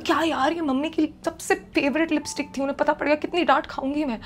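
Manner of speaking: high, loud, rushed panic; panicked scolding. Retold or imitated. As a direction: scold him in a frantic rush. Panicked scolding over broken lipstick. (घबराकर ऊँची, तेज़ डाँट)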